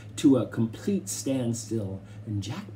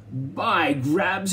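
roaring; 6 semitones higher, 3 dB louder than usual. A narrator's reading. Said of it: "he roared".

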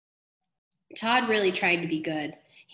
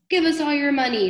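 raised, higher robber's demand; 6 semitones higher, 6 dB louder than usual. A narrator's reading. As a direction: demand it louder and higher, as in a read-aloud holdup. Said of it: "they demanded".

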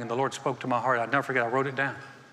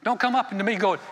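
ordinary talk, nothing marked

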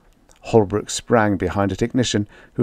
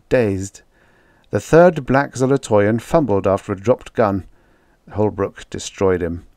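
usual speaking voice

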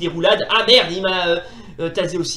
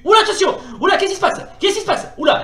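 high, loud startled cries; 10 semitones higher, 5 dB louder, faster than usual. Real, not acted. Startled as a new character appears, alarmed. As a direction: jump at something sudden. Sudden surprise during fight.

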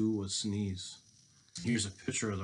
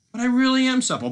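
high, loud, hurried insistence; pleading storybook boy; Seppel; 12 dB louder, faster than usual. Acted. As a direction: protest fast in a little high voice. Captive Seppel insists, voiced.

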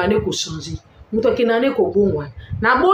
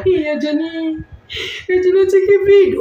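mock wailing; 10 semitones higher, 4 dB louder than usual. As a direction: wail it out loud like a put-on mourner. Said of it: "ba olela". (kolela ya lokuta na mongongo ya likolo)

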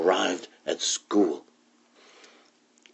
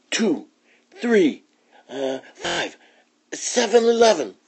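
much higher unsure counting pup; proud counting; 13 semitones higher than usual. Acted. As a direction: count like a proud little pup who guesses.

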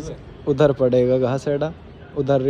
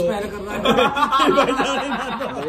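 high cracking laugh; big laugh; 11 semitones higher than usual. Real, not acted. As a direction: laugh out hard at the joke. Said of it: "देख कैसा हंस रहा है".

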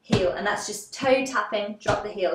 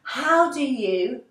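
higher, drawn-out mock shock; 4 semitones higher, much slower than usual. Teacher demonstrating Alice's question to the cat, shocked and drawn out. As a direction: stretch the question in theatrical surprise. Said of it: "we're shocked".

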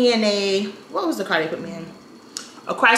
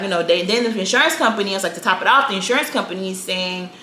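usual speaking voice

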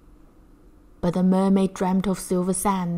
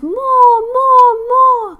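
much higher, loud crowd shout; roaring crowd; 11 dB louder, much slower than usual. Acted. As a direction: bellow like a cheering circus crowd. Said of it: "came the deafening roar".

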